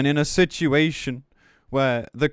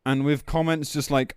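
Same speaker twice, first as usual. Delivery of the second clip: usual speaking voice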